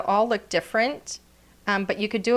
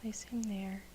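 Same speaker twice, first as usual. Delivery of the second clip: whisper; 15 dB quieter than usual. Real, not acted. Crafting, murmuring to herself.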